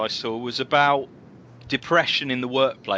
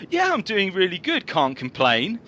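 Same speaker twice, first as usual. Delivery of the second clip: breezy colleague; 8 semitones higher than usual. Retold or imitated. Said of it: "this guy just goes".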